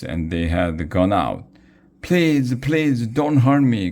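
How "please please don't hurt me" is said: high, loud pleading; begging cry. A narrator's reading. Morty begging the ghosts, high loud frightened plea. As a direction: beg for mercy on your knees. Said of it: "cried Morty".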